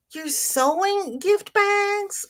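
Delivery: high, slow, amazed question; delighted surprise. Real, not acted. To Rita sewing gift bags, a high, slow, delighted question. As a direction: gush with delight at the news.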